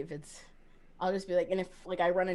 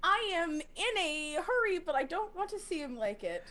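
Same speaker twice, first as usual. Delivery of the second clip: hurried but feigning casual; 10 semitones higher than usual. Acted. Player voicing Trip's fast walk to the car, feigned casual. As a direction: hold back the rush, stretching words. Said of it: "fast walk jog where it's like".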